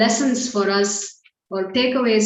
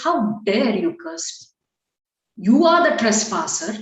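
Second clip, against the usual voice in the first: acted indignation; 6 semitones higher than usual. A cursed sage confronts the trespasser, acted indignant outrage. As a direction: draw out the accusations, building higher in indignation. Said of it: "Vedashira gets very upset".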